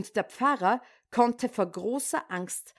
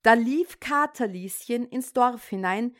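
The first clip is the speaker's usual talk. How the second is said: ordinary delivery, nothing marked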